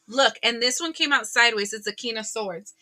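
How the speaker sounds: usual speaking voice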